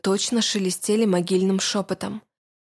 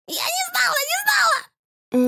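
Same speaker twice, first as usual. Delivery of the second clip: shrieking witch. Acted. Witch pleads in terror.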